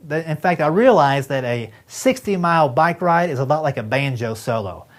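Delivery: usual speaking voice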